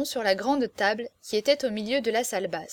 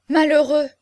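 loud, high alarmed exclamation; frightened cry; 5 semitones higher, 10 dB louder than usual. A narrator's reading. Aurore scolding the indiscreet Berrichon, crying out in fright. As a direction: cry out in alarm, much louder. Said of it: "s'écria Aurore effrayée".

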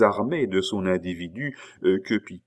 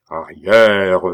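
loud, drawn-out order; commanding shout; 10 dB louder than usual. A narrator's reading. Abbé advances on Pitou, commanding shout. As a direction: drive him back with one forceful word.

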